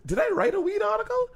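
asked way up high in alarm; mock alarmed squeak; high-pitched panicked question. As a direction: squeak it out in disbelief. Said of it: "I had to say to you".

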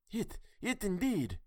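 high, slow stammered gibber; a man whose wits gave out. A narrator's reading. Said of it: "gibbered one".

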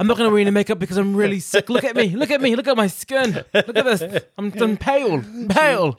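high-pitched, theatrical invalid; Crystal. Acted. Contestant showing off being really sick, melodramatic. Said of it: "Crystal was embracing that. He's like".

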